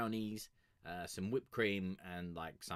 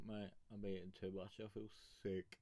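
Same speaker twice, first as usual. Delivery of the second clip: ordinary talk, nothing marked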